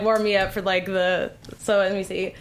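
ordinary delivery

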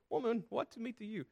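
amused repeat of Jesus' words. Retold or imitated. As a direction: say it chuckling at its oddness. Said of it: "I don't know why I'm laughing".

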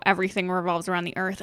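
ordinary talk

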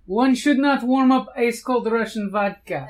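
loud Russian barbarian voice. Acted. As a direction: boom it out like a barbarian. In-character game figure line.

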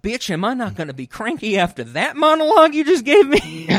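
high, loud indignant comeback; husband retorting to his lecturing wife; mock indignant retort. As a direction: snap back, hammed-up. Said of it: "And you're like".

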